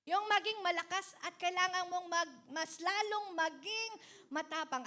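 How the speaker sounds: fiery pulpit preaching; preaching at full force. Acted. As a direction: proclaim the verse to the congregation. Preacher builds to a challenge.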